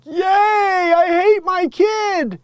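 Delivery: high, loud, slow mock wail; mock shrieking mother. Mocking the mother's quote.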